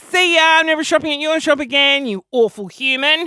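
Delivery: loud, high mock outrage; indignant outburst. Customer telling off a shop owner, mock indignant. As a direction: storm off with a loud parting shot.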